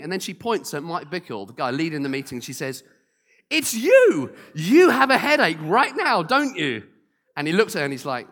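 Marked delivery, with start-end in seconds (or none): 3.5-6.8 high, loud and certain; accusing shout. Retold or imitated. Lady on stage loudly telling Mike Bickle he has the headache. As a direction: point and declare it loudly.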